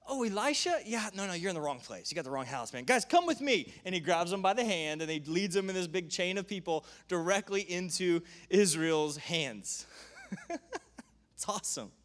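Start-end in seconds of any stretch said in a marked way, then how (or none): 0.1-3.7 sly friendly stranger; casual trickster. Retold or imitated. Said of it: "He's like". 9.9-11.0 gleeful high-pitched laugh; giddy shriek; high squeal of laughter. Real, not acted. Delighted squeal after story.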